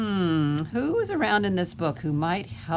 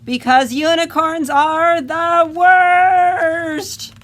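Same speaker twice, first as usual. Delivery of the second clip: much higher and louder, slow, drawn-out shout; shouted with the kids; 10 semitones higher, 10 dB louder, much slower than usual. Shown as a demonstration. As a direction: belt it out with the children. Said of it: "say it really loudly".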